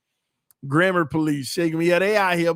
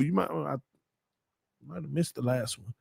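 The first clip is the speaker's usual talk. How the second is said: usual speaking voice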